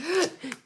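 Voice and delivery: high breathy gasp of awe; exaggerated starstruck gasp; mock adoring gasp. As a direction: gasp like a swooning fan. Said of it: "She's like".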